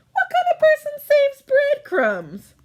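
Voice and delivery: high, loud indignant squeal; outraged that anyone saves crumbs; indignant outburst. Acted. Indignant at breadcrumbs.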